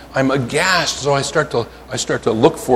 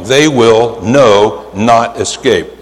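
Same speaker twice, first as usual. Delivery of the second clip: slow, stress on not; slow and emphatic; emphatic double negative; 2 semitones lower, much slower than usual. Shown as a demonstration. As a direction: hit no and not hard. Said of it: "the emphatic form of the double negative".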